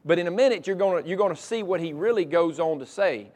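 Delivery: normal talking voice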